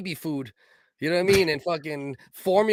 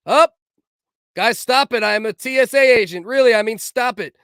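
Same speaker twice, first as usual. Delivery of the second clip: yelling; 7 semitones higher, 9 dB louder than usual. Retold or imitated. Scolding people in a video.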